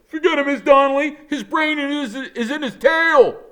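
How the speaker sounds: rowdy classroom shout; kids shouting. A narrator's reading. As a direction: heckle the teacher across the room. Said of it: "kids are shouting stuff like".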